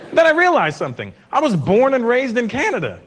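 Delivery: usual speaking voice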